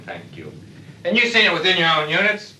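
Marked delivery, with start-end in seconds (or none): 0.0-0.5 fading mock thank you. Shown as a demonstration. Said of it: "you think you're fine".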